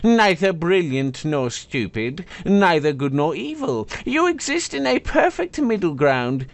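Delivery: high, scornful magical blade; haughty sword voice. Acted. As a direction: sneer down at him from above. Cursed sword mocks Ren.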